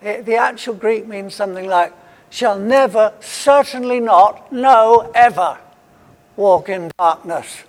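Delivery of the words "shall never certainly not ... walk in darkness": each word hammered out, never ever; emphatic. Shown as a demonstration. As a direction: stress every word of shall never certainly not no ever. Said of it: "it's very emphatic".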